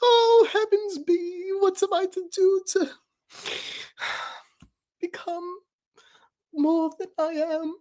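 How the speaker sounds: high theatrical sighing woe; melodramatic lament. Acted. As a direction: moan it like a tragic hero. Dramatic self-pity bit.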